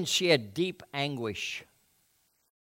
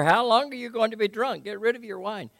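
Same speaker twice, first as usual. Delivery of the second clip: higher, mild mock rebuke; Eli scolding; 10 semitones higher, 4 dB louder than usual. Eli rebuking praying Hannah, higher mock scolding. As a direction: chide her like a stern priest. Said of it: "And he said to her".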